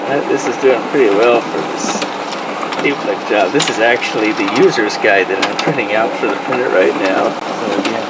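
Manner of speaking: speaking up over a whirring printer. Real, not acted. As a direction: project loudly over machine noise. Talking over running printer noise.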